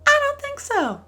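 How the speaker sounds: squeaky high I don't think so; I sing it out way up high; mock high refusal. Real, not acted. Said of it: "emphatic refusal".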